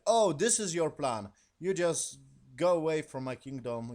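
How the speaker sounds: loud, high imitated scolding; mock angry king. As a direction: throw him out like an outraged king. King throws the uncle out.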